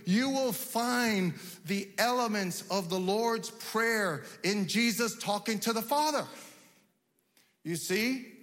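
ordinary delivery

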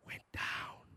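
hushed whisper; whispered aside. Real, not acted. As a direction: drop to a breath on it. Sinking emotional climax.

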